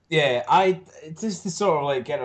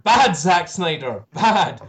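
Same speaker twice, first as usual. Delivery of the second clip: louder, higher scolding tone; scolding a dog; 7 semitones higher, 5 dB louder, slower than usual. Acted. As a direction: tell off a naughty dog. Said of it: "talking to Zack Snyder like he was a dog".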